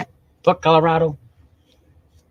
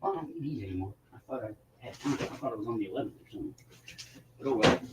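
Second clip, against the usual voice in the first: much softer, fast mumbled aside; muttering to himself; 3 semitones lower, 14 dB quieter, faster than usual. Real, not acted. Talking to himself about a missed date, soft mutter. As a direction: mumble it under your breath, quickly.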